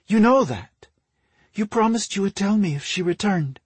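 high-pitched voice of an anxious wife; pleading wife. A narrator's reading. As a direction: plead with your husband, pitch lifted throughout. Wife pleads with her corrupted husband.

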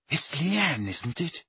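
soft, tender coaxing; gentle and soft. Acted. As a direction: speak softly to a frightened girl. Hermione comforts a crying girl.

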